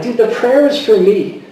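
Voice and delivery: half-mad protest at God; getting mad. Retold or imitated. Father arguing with God in prayer, exasperated. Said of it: "then I started getting mad".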